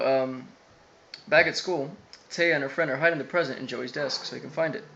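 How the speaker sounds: normal talking voice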